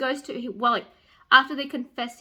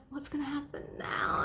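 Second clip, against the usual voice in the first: hushed, worried whisper; 3 semitones higher, 9 dB quieter than usual. Retold or imitated. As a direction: breathe it out in suspense. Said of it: "I'm like".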